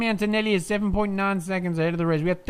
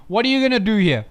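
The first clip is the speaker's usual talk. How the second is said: normal talking voice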